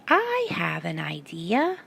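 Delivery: soft, slow little voice; small voice. A narrator's reading. Little Bandicoot offers an idea, soft small voice. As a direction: say it small and shy. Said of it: "heard a small voice say".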